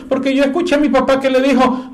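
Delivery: high child's voice, imitating a kid; little boy explaining. Little Pepito explains to his mother in a high child's voice. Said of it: "le dice a la mamá".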